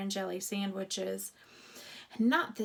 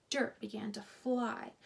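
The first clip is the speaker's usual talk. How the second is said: ordinary delivery, nothing marked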